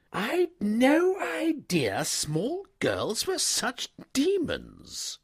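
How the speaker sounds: slow, appalled upper-class gent; aghast young toff. A narrator's reading. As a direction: marvel in horror. Wooster aghast at the girls.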